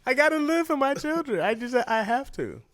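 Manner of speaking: high, laughing, put-on plea for the children; mock dramatic plea. Pleading to followers she must eat fish, high laughing mock plea. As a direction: ham it up like a tearful parent.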